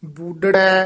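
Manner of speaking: ਬੂਡੜੈ with a firm, tightened ਡ; tightened pronunciation. Shown as a demonstration. Teacher showing students a tightly pronounced ਬੂਡੜੈ. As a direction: press on the ਡ in ਬੂਡੜੈ. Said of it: "ਇਹ ਵੀ ਥੋੜ੍ਹਾ ਜਿਹਾ ਕੱਸ ਕੇ ਬੋਲਣਾ".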